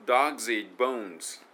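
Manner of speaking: slow, loud, every word stressed evenly; slow teaching read of dogs eat bones; rhythm demo. Shown as a demonstration. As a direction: lay out each beat of the English rhythm. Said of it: "例えばこれ".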